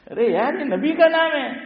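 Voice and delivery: high, loud exclamation of dismay; exclaimed protest. Real, not acted. Cleric objecting to mangling the Prophet's name, high loud protest. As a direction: cry out in high-pitched protest.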